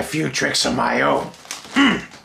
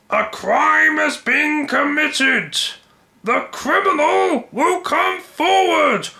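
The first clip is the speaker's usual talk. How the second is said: tyrant voice; 11 semitones higher than usual. Acted. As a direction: proclaim the threat to everyone. Tyrant declares a crime.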